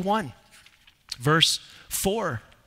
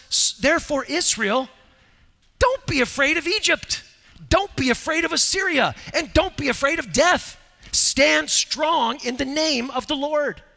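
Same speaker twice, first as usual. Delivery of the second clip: rally Israel against its fears; fiery preaching; 9 semitones higher than usual. Acted. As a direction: prophet calling Israel to courage. Voices Isaiah's charge to Israel.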